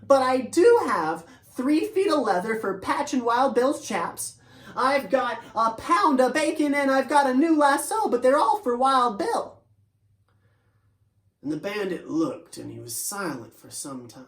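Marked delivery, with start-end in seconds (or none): 0.0-9.6 higher, louder plea as Tempo; a young horse explaining his load; voiced horse Tempo. Young horse Tempo talking to the bandit, higher and louder.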